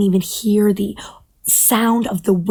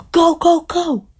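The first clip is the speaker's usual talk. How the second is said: high, urgent cries; frantic high-pitched plea; urgent urging; 8 semitones higher, 5 dB louder than usual. Acted. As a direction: urge your ride to move, pitched way up. Bird urges turtle to flee.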